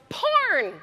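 high, sudden yelled heckle; audience heckle yell. Retold or imitated. Audience member yelling out a genre suggestion, a high sudden yell. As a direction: blurt it out from the crowd. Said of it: "somebody from the audience yells".

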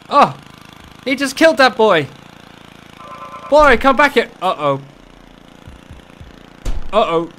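ordinary talk, nothing marked

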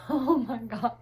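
usual speaking voice

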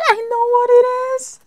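high, fast and loud with joy; excited shriek. Real, not acted. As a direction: blurt it out, thrilled and breathless. Thrilled recognition of gift.

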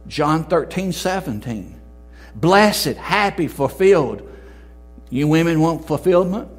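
loud, high fervent preaching; full-force revival sermon. Acted. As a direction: thunder the verse at the congregation.

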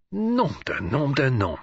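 slow, astonished French exclamation; exclaiming. A narrator's reading. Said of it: "He ejaculated".

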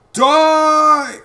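shouted high battle cry. Acted. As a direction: yell it as you strike. Battle cry while fighting.

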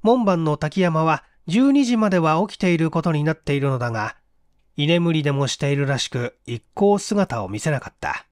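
ordinary delivery

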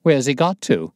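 urgent loud demand; alarmed cry. Acted. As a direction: call out looking for him. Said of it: "cried the inspector".

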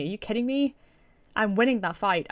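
normal talking voice

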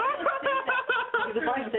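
slow, high words squeezed through laughter; laughing hard. Real, not acted. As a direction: talk while helplessly laughing. Cracking up at parents joke.

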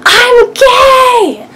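high, loud sobbing yell; crying shout. Retold or imitated. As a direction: blurt it out crying at full voice. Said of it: "I remember just yelling out".

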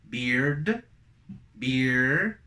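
"beard" with final d, "beer" without, both rising; list drill. Shown as a demonstration. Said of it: "Entonces quedaría". ("beard" y "beer" ascendentes, con I)

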